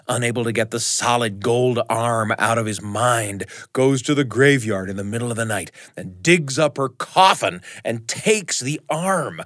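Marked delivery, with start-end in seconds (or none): none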